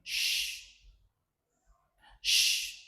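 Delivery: "sh" as a long voiceless hush sound; phonics-style drawn-out "sh"; SH sound demo. Shown as a demonstration. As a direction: hold a lone "sh" with no vowel, pause, repeat. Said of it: "And the third one is".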